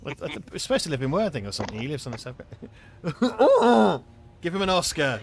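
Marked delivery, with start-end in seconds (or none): 2.4-4.4 loud, high, wordless mumbled groan; hammed-up mumble with no words; mock mumbling. Mocking a mumbling actor, loud wordless mumble. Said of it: "He's mumbling again".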